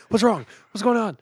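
high, alarmed mom; imitating a startled mom waking suddenly. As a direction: blurt it out jolted from sleep.

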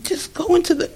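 mock urging from the other women. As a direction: coax her gently through the door. Women urging the crying woman.

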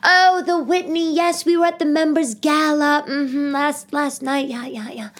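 mock wealthy members comparing their galas; rich New York mother. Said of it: "the rich mothers in New York".

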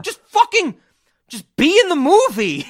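high, loud frustrated outburst; exasperated yell. Retold or imitated. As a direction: yell at the character to just act. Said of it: "I was like".

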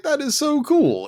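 excited. Retold or imitated. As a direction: gush like a kid seeing the trailer. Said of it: "I was like".

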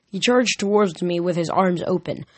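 ordinary delivery

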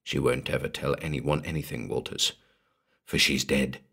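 low, hushed disclosure; soft revelation. A narrator's reading. Morden telling Walters that Tessa is dead, softly. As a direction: break the grim news very quietly. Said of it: "Sam Morden said softly".

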